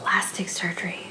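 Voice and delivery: hushed breathy whisper. Real, not acted. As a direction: whisper it under your breath.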